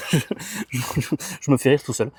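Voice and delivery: normal talking voice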